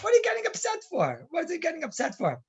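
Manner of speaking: high-pitched exasperated question; pitched right up, falling at the end; incredulous challenge. Acted. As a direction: protest as if the upset makes no sense. Teacher challenges upset Balak.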